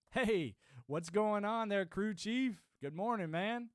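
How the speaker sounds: high, loud, slow hello called out; loud greeting. Real, not acted. Greeting the crew chief in chat, loud and drawn out. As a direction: call out a big welcome to a friend.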